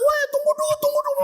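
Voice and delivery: very high pleading cry; mock yelling. Rumi begging the mob to wait, high imitated yell. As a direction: cry out to halt a beating. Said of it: "Ruminya kan teriak-teriak".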